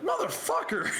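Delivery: mock outburst. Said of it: "and be like".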